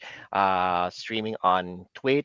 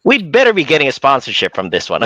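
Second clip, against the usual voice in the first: loud joking; 5 semitones higher, 12 dB louder than usual. Real, not acted. Joking about a sponsorship.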